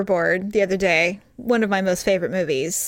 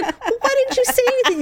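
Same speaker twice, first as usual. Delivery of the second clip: higher, fast disbelieving question; quick high protest to husband; incredulous question; 14 semitones higher, faster than usual. Retold or imitated.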